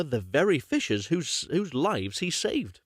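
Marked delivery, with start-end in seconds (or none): none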